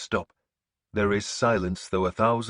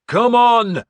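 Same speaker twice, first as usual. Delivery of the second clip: sharp yell, much higher and louder; shouted rally; 12 dB louder than usual. A narrator's reading. Leader leaping into the ditch calling men on, loud yell. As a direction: call them after you into the ditch. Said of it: "I shouted".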